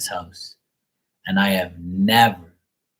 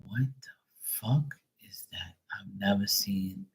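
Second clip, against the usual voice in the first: softer, whispered awe at the dove; awed whisper; 9 dB quieter than usual. Retold or imitated. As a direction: breathe it out like you can't believe it. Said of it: "and I was like".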